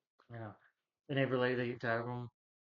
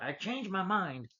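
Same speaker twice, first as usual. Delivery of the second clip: high, slow, drawn-out call; hammed-up announcement; mock exclamation; 7 semitones higher, 4 dB louder, slower than usual. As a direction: stretch it out in a raised, acted voice. Retold boss announcing change of mind.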